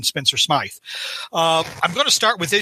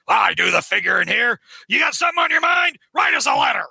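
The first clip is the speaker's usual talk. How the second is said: high, blustering newspaper boss; J. Jonah Jameson; 6 semitones higher than usual. Acted. Jameson tells off the protester, high blustering mock shout. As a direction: shout it down, hammed-up and indignant. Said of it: "That's a good Jameson".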